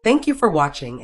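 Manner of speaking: ordinary delivery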